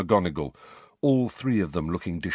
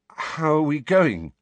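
shaken; 8 semitones higher, 4 dB louder than usual. A narrator's reading. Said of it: "looking shaken".

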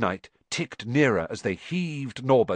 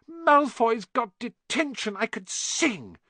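delighted Hermione; 13 semitones higher, slower than usual. Acted. Hermione delighted, doing a jig.

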